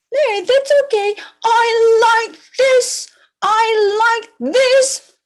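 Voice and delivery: high-pitched horse voice; horse character. Acted. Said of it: "What did horse say?".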